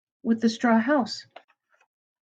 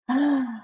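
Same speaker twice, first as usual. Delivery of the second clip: mock gasp; 6 semitones higher, 3 dB quieter than usual. Reacting to surprise ending.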